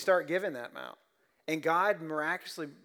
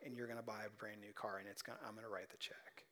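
softly revealing a secret gift; hushed whisper; 5 semitones lower, 17 dB quieter, faster than usual. Retold or imitated. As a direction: whisper it, trailing off, a bit quick. Friend's quiet gift announcement.

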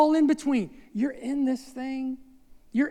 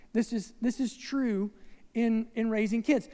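ordinary talk, nothing marked

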